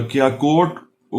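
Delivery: usual speaking voice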